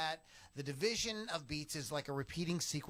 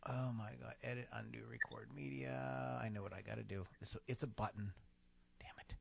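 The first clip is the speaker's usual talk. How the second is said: muttering; 5 semitones lower, 8 dB quieter, faster than usual. Real, not acted. Fumbling with recording software.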